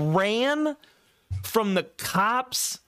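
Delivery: mock panicked rant, slow and high. Retelling friends his jail panic, high mock wail. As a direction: replay his own freak-out, drawn out. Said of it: "I was like".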